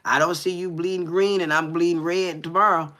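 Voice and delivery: ordinary talk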